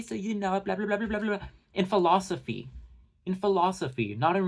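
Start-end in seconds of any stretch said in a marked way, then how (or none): 0.0-1.5 high put-on voice running into nonsense; mocking babble. Commentator mocks Rachel going on about her degree, babbling. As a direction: rattle it off as empty bragging. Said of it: "talking about how she's educated".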